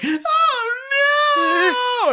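high, loud, crying mock wail; hammed-up mother wailing over the garage door; dramatic mom wail. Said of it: "she's so dramatic".

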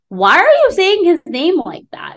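high, loud scolding mother's voice; a mother scolding the children for mispronouncing a name; scolding mom. Retold or imitated. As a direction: play the indignant mom correcting her kid. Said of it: "my mom were both like".

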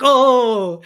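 sudden high loud "Oh!"; surprised yelp. Retold or imitated. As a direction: jolt of happy surprise. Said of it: "and you're like".

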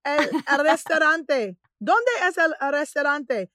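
eager tourist attempting Spanish; halting learner's Spanish. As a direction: try out your few Spanish words, haltingly. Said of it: "trying to use my Spanish".

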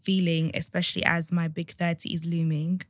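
measured, subdued read-aloud voice; letter reading. A narrator's reading. Host reading a listener's dilemma to co-host, subdued read-aloud.

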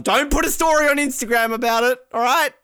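high-pitched comic scolding; mock yelling. Host telling off the skier over Instagram, mock yelling. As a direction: rant at her as if truly fed up, hammed up. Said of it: "excited 'cause he can yell again".

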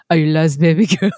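low mock seductive line through a laugh; hammed-up low flirt; imitating a smooth-talker. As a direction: put on a low crooning pickup voice, half laughing. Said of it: "just hoping to hear".

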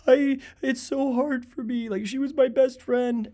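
high, drawn-out lament; imitated grieving mourner. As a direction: wail it slowly, imitating a mourner. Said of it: "people are coming like".

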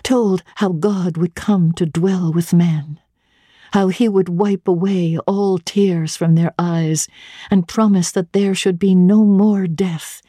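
usual speaking voice

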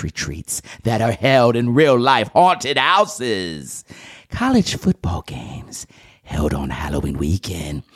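hammed-up creepy announcer with exaggerated swoops; mock-scary show intro, stretched and theatrical; spooky Halloween host. Opening the Halloween podcast for listeners, hammed-up spooky voice. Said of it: "That was a terrible voice".

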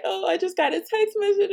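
high-pitched mock weepy voice; imitated tearful wife. Brother's wife caught crying in the bathroom, mock tearful excuse. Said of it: "the brother's wife crying her eyes out".

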